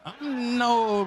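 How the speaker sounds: ordinary delivery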